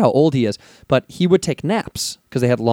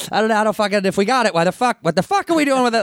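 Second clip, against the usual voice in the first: rising higher, exasperated rant; mock temper tantrum building higher and faster; imitating angry Tom; 9 semitones higher, faster than usual. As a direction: blow up at the director. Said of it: "And Tom would go".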